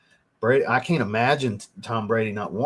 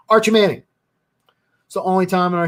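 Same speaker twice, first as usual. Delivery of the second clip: much higher and louder, a frustrated shout; exasperated outburst; 7 semitones higher, 6 dB louder, faster than usual. Real, not acted. Ranting fan repeats the name in loud frustration. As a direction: blurt the name out in disbelief. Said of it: "I'm getting worked up".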